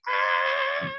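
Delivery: low startled yell; shocked scream. Retold or imitated. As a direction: let out a shocked cry. Kissed in front of club.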